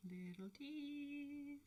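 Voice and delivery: high, slow sing-song tease; playful teasing. Real, not acted. Repeats the phrase playfully.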